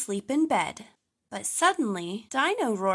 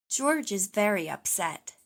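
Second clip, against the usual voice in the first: plain reading voice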